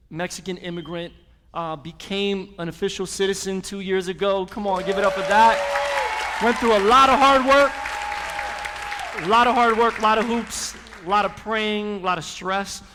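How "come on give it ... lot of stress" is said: loud, high rallying call to the congregation; hyping the crowd. Real, not acted. Pastor rallying the church for a new citizen, loud and excited. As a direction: pump the room up, calling out each hardship.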